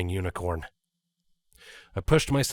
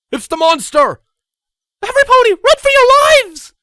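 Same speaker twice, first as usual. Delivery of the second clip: shrill panicked scream, very high; terrified screaming; 16 dB louder than usual. Acted. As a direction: scream as the monster comes. Ponies shrieking in terror.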